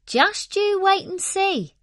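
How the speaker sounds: the tortoise answering the laughing hare; confident tortoise. A narrator's reading. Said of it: "said Archibald".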